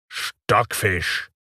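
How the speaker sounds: contemptuous spat insult. Acted. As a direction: spit the word out angrily. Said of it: "wurde Hook entgegengespuckt".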